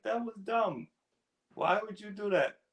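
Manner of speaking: scolding himself. Retold or imitated. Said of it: "I'd be talking to myself like".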